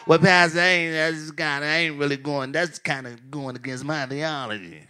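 a hint of a mumbling, low excuse-maker; lightly mock the reluctant member; whiny churchgoer voice. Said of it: "I don't know why they talk like that. That's the voice they use".

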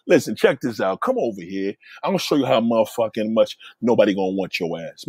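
low-pitched coaxing, re-enacted; low seductive. Man re-enacting his reply to an upset lover, low coaxing voice. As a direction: drop well down in pitch to coax her. Re-enacts summoning the woman over.

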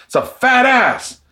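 high, slow leering catcall; mock catcall from the curb. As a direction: drawl it out at a passing woman. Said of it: "He's like".

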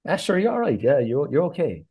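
easygoing Irish farmer. Acted. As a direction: wave away the crash like it's nothing.

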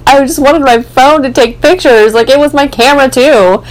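a touch of frustrated whining; retold frustration. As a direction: vent about the lost phone. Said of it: "I'm so mad".

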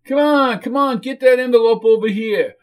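loud, high-pitched shouted order; impatient yell. Acted. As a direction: yell across the room to hurry them up. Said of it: "yelled Dulio".

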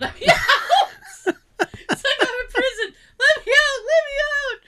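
high, loud laughing wail; hammed-up let me out; mock prisoner plea. Pretend prisoner pleading, laughing.

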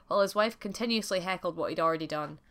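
plain reading voice

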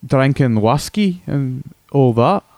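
hammed-up Scottish brogue; broad comic Scottish accent. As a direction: play a crude Scotsman caller on the radio. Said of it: "crude impression of a Scotsman".

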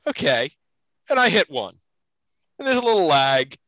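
normal talking voice

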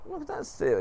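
very high, sing-song delight; wife's voice. Retold or imitated. Wife lures husband to cliff edge, high sweet voice.

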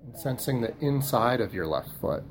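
slow, soft body-scan voice with long pauses; guided meditation. Acted. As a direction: guide them gently through the body, softly and unhurriedly.

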